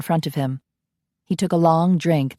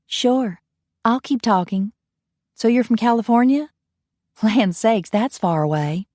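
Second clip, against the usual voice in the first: Southern belle bartender; 5 semitones higher than usual. Acted. Bartender Coralie chatting on the phone to California, high belle voice.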